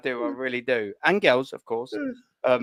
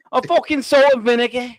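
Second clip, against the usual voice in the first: higher-pitched, badly faked Scouse; mock Scouse accent; 4 semitones higher, 3 dB louder than usual. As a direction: ham up a Liverpool accent. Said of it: "There's the Scouse accent".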